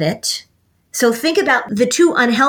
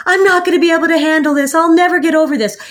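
rushed, higher and louder mock breakdown; overwhelmed despair; 7 semitones higher, 3 dB louder, much faster than usual. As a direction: spill it out fast like you're falling apart. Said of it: "Or you said".

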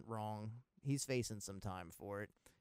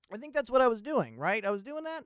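mock confusion; 11 semitones higher, 9 dB louder than usual. Real, not acted. Confused, checking with himself.

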